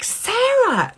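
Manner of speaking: high, loud imitated cry of delight; gushing recognition. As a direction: greet her like a dear friend, hammed up. Celebrity fakes warm recognition.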